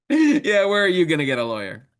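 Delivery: loud, high, mocking punchline; gloating devil. Devil taunting God in a joke, gloating and loud. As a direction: taunt loudly as a hammed-up devil. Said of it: "The devil smiles and he looks up".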